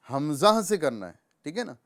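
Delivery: ordinary delivery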